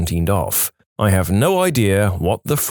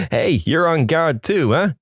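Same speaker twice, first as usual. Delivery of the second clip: higher, drawn-out mock call; mock yell; 6 semitones higher than usual. Pilots heckling each other on the radio, mock yell. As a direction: heckle in a slow, raised-pitch call. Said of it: "without people yelling".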